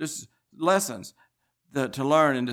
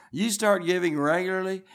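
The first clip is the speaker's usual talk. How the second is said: ordinary talk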